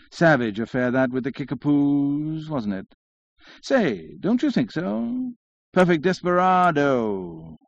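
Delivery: slow, swooping comic drawl; drawling syllables. A narrator's reading. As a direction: draw out the last word of each phrase. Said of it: "drawling out his syllables".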